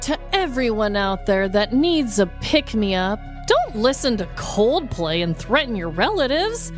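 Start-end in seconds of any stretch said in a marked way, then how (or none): none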